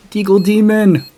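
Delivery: loud hyped praise; excited shout. Real, not acted. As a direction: cheer your teammate on. Praising a teammate's kill.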